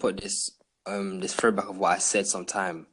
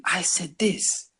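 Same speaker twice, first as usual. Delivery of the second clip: high, drawn-out disbelief at yourself; appalled realization; 8 semitones higher, much slower than usual. Retold or imitated. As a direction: stretch it out slowly, mock-appalled.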